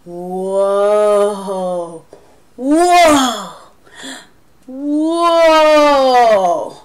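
drawn-out amazement; awed whoa. Shown as a demonstration. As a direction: build the wonder on each whoa. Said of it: "emphasize the word tall".

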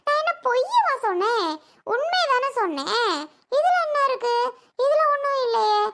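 high-pitched, indignant self-defence; defiant protest. Acted. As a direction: insist you told only the truth. Acted quarrel, defiant self-defence. (எதிர்த்து வாதாடும் குரல்)

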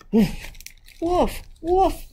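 high mock dog barks; cried barks. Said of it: "he cried".

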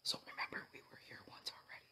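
hushed whisper; low whisper to the camera; nervous night vlog whisper. Real, not acted.

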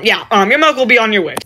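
ordinary talk, nothing marked

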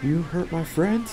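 soft, slow threatening question; quiet menace. Pretend. Teammate wounded, mock threat.

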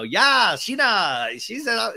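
high, hyped-up bad impression; horrible Brock voice. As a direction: badly imitate an excited, supportive guy. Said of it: "that's my horrible Brock voice".

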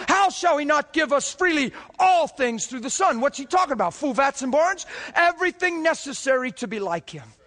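fired-up revival preacher; preaching at full force. Acted. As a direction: preach it out with growing fire.

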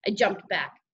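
ordinary delivery, nothing marked

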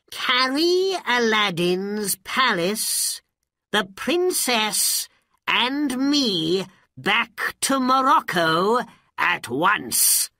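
high, loud, imperious order; commanding magician. A narrator's reading. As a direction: order the genie about, loudly and deliberately.

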